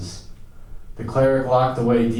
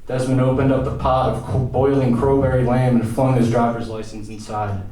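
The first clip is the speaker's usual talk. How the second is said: usual speaking voice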